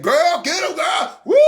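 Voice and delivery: high-pitched moviegoer's holler; acted excited cheering. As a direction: cheer on the heroine at the screen. Acts excited moviegoer yelling at screen.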